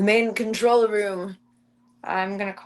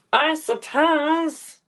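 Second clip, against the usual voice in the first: very slow, higher, louder grudging "I suppose"; higher mock sigh; 7 semitones higher, 4 dB louder, much slower than usual. Real, not acted. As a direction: overdo the reluctance, dragging it out.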